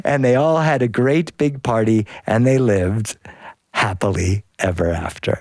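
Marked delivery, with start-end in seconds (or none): none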